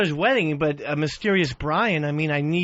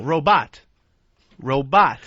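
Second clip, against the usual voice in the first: ROBOT with "bot" punched out; stress on second syllable; 5 semitones lower, 3 dB louder, slower than usual. Shown as a demonstration. Co-host drilling his partner, second-syllable stress. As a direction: hit the end of ROBOT hard, "ro-BOT". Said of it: "Second syllable".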